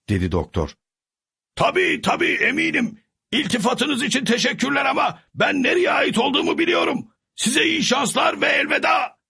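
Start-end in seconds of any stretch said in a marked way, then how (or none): 1.6-9.2 high called farewell; calling out. A narrator's reading. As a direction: call goodbye as you ride off. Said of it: "diye seslendi Sancho".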